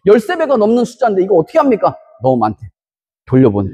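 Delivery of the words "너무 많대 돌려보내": low, flat divine command; God's voice. Retold or imitated. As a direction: drop deep and give the order as God. God's answer again.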